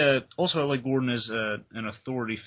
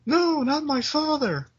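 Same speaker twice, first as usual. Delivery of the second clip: much higher, slow, desperate cry; pleading; 4 dB louder, slower than usual. Retold or imitated. Barbara pleading with the gunman, imitated frightened cry. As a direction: beg for her father's life. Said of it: "Barbara's in the room saying".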